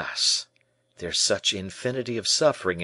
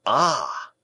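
raised, mildly distrustful exclamation; fresh distrust; 5 semitones higher, 4 dB louder than usual. A narrator's reading. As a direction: exclaim with a touch of suspicion. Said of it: "with fresh distrust inspired by the word".

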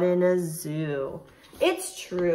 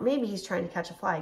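usual speaking voice

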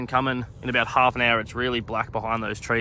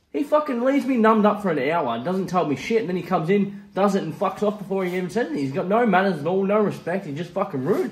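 higher-pitched, worked-up retold rant; indignant complaint; 7 semitones higher than usual. Patient complaining to nurses about the dentist, indignant. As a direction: re-enact an aggrieved complaint, pitch raised.

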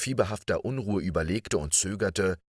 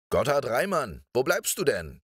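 teacher's call, pitched high; higher, swooping call, not a full shout; 6 semitones higher than usual. A narrator's reading. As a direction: call over to a dawdling pupil. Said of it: "rief ihm der Lehrer zu".

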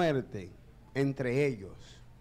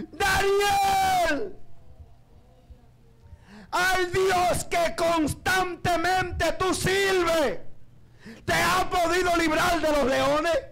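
king's anguished cry; 13 semitones higher, much slower than usual. Acted. King Darío at the lions' den begs for an answer, crying. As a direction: wail the question with a breaking voice. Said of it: "el llanto del rey Darío fue este".

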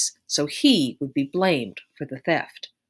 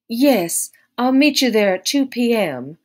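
high, loud instruction; directing; 6 semitones higher, 6 dB louder than usual. A narrator's reading. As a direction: call it out as an order. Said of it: "Belle directed".

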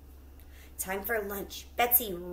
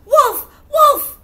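very high, very loud yells of wolf; hollering; 18 dB louder than usual. Acted. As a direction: holler for the farmers. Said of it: "She hollered".

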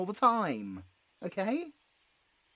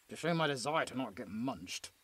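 lower, softer muttered truth; nervous aside; 9 semitones lower, 8 dB quieter than usual. Acted. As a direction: mumble the real fear out of earshot. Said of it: "I said to show my desire".

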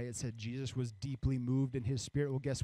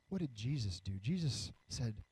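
ordinary talk

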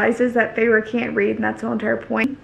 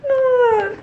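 high, drawn-out cry of reaction; high squeal; 12 semitones higher, 4 dB louder than usual. Real, not acted. Reader reacts to the escalating scene, high-pitched squeal. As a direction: squeal out over the page.

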